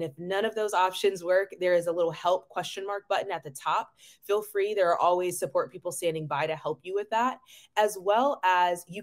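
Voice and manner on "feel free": ordinary talk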